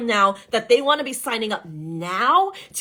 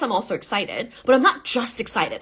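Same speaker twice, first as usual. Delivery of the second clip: usual speaking voice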